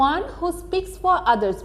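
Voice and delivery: very slow, each English word read out clearly; slow question read. Shown as a demonstration. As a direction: read the question slowly for the class. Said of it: "প্রথম প্রশ্ন আছে".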